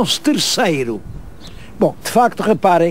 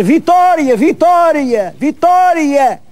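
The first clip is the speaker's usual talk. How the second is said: high, drawn-out imitation of cheering villagers; retold crowd cry; 8 semitones higher, much slower than usual. Villagers on the walls cheering on the washerwoman, imitated high cry. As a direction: retell the chant with raised pitch, slowing on the last. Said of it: "estavam todos a gritar".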